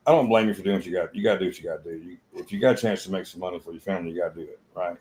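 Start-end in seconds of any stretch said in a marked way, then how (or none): none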